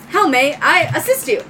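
high, loud robot helper; little droid. Acted. Little droid offering help to owner, high robotic voice. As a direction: offer service in a small robot's voice. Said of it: "your little light droid".

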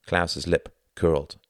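ordinary delivery

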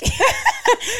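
squealing burst of laughter; high-pitched laugh. Real, not acted. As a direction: shriek with laughter. Laughing at own story.

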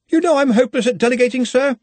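fast, high, loud pleading; panicky protest. A narrator's reading. Ponder pleads with his departing boss, panicky. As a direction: rush it out in dismay.

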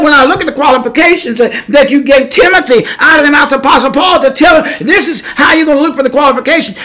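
loud, fast protesting; heated protest. Retold or imitated. Preacher protesting to the Lord, loud and fast. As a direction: press the point hard. Preacher retells his words to God.

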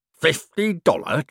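slow, put-on growl of outrage. A narrator's reading. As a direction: growl the sum in disbelief. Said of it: "growled Cribbins".

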